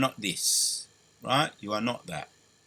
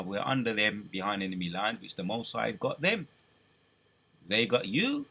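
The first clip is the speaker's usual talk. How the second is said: normal talking voice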